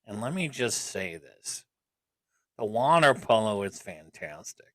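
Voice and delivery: low, slow, creepy murmur; creepy man voice. Pretend. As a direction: drop into a creepy stranger's voice. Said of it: "why are you saying it like a creepy man right now".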